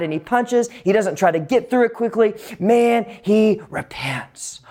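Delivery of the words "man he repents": hushed, breathless whisper; a slow whispered aside. Acted. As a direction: drop to a whisper for weight. Preacher whispers Daniel's repentance.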